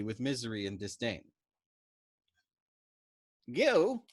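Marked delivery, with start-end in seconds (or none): 3.5-4.1 mournful accusation. A narrator's reading. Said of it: "exclaimed mournfully with accusing disgust".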